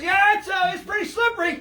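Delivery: high, loud yelled quote of a scared mate; mock shout. Fisherman retelling his mate shouting from the whale, a high mock yell. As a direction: yell it like a friend clinging to a slippery whale.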